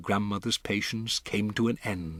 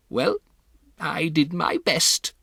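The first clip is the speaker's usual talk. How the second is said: higher, slow, resigned dignity; grandmother's voice; 7 semitones higher, 4 dB louder than usual. Acted. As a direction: shrug it off with dignity. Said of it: "my grandmother said".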